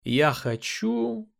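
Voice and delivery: mock pondering. A narrator's reading. Man toying with the cornered princess, mock-thoughtful. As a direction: stretch it out as if pretending to think. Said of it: "сделал наигранное задумчивое лицо".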